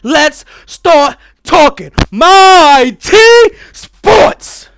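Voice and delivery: loud, high, slow hype intro; hype announcer. Acted. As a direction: pump up the crowd.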